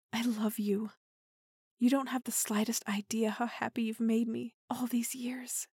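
loving hushed whisper; tender whisper. Acted. Tender confession of love.

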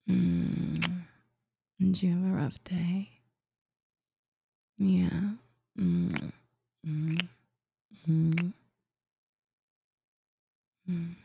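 ordinary delivery

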